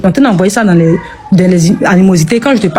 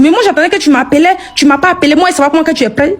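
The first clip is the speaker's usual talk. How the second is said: mock aggressive; 8 semitones higher than usual. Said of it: "elle a commencé à être agressive".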